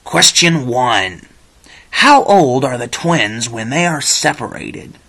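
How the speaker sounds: loud, big game show host voice. Acted. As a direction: pump up the contestants like a TV host. Host opens the quiz show.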